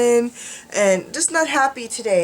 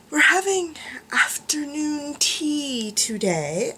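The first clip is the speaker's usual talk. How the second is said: whispering; 3 semitones higher, slower than usual. Real, not acted. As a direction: whisper it slowly like a secret. Whispered aside about tea.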